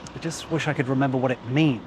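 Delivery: usual speaking voice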